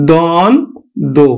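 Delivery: দ্বন্দ্ব sounded as দন দো, the ব-ফলা left silent; syllable by syllable. Shown as a demonstration. Teacher showing students how দ্বন্দ্ব is said. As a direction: split দ্বন্দ্ব into দন and দো. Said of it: "আমরা উচ্চারণ করি কিন্তু কি".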